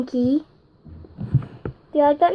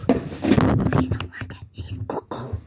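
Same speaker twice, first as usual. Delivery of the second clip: unvoiced whisper, slow; 5 dB quieter than usual. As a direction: whisper it like a secret. Secret whispered aside.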